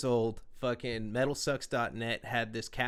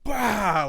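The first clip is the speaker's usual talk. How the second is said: loud, high "bah" of violent effort; imitated smashing roar; 8 semitones higher, 9 dB louder than usual. Storyteller acting dad wrecking the guitar, explosive imitated roar. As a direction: act out a furious smash with one burst.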